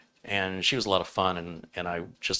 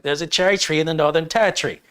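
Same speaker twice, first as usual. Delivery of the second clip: higher, louder mimicked Aussie, R's dropped; Australian accent; 7 semitones higher, 6 dB louder than usual. Australian racer repeating the test sentence, in Aussie accent. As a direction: imitate an Australian woman saying the test phrase. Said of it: "You have an accent".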